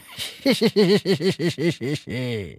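long high-pitched tittering laugh; gleeful snicker that falls at the end; mocking, drawn-out tee-hee; teasing giggle. Master laughs at his own joke.

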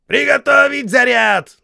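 high, loud barked order; shouting drill sergeant. A narrator's reading. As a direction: bark the drill command. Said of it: "подал команду сержант". (строевая команда)

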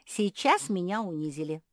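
affronted and firm; cutting retort. A narrator's reading. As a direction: state the insult with finality. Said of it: "отрезала Квакушка".

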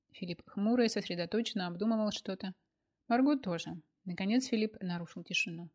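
plain reading voice

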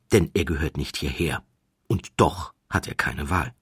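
ordinary delivery

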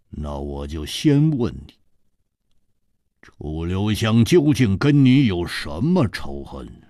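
gruff interrogator. Acted. Butterfly Flower grilling Li Yuhan, low and slow. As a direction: press him with low menace.

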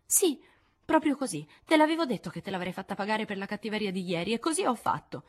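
acuta e dispettosa; bambina vendicativa. Acted. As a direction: voce di bimba che si vanta. Amy rivendica la vendetta. (Amy si vanta con Jo, dispettosa)

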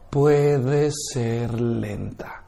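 drawn out slowly and softly, almost a murmur; slow speech demo. Shown as a demonstration. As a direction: stretch every syllable. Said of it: "La velocidad con la que hablamos".

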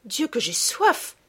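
slow, drawn-out thirsty cry; a girl worn out after running; parched child. Acted. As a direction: drag it out, dying of thirst. Sophie exhausted, forbidden to drink.

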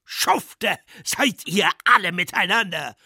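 high, loud abusive yell; furious shout. Acted. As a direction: yell insults at everyone. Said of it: "schrie der Scheitler". (wütend geschrien)

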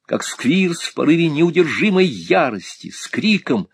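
usual speaking voice

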